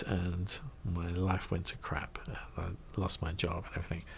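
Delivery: whispered, rueful; hushed aside. Real, not acted. Telling listeners how he lost his job, whispered. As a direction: drop to a whisper for the bad part.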